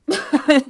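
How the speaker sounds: laughing at a day gone wrong; loud high laugh. Real, not acted. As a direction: burst of exasperated laughter. Laughing at dog peeing.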